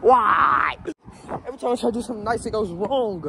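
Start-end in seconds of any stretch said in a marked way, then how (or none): 0.0-0.8 frustrated shout. Real, not acted.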